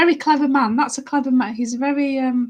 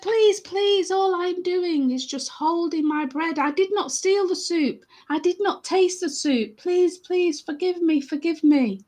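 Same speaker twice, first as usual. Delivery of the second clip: high, imploring poor man; earnest storybook plea, pitched up; pleading; 5 semitones higher, slower than usual. Acted. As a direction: beg forgiveness in an acted voice. Poor man begs the chef.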